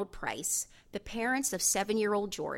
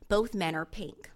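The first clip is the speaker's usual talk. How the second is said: plain reading voice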